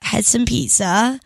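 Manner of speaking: slow, drawn-out attempt at an American accent; stretched-out mock American accent. Shown as a demonstration. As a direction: put on a hammed-up American accent, stretching the words. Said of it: "Can you do an American accent?".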